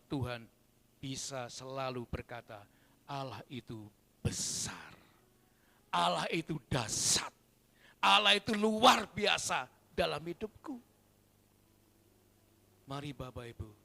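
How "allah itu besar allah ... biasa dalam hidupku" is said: building praise. Acted. Congregation's praise, preached climax.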